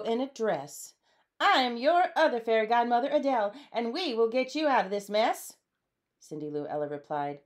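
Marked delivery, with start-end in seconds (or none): 1.4-5.6 high, loud, confident introduction; high-pitched take-charge godmother; armadillo fairy godmother. A narrator's reading. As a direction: declare it proudly in a raised voice. Second fairy godmother introduces herself.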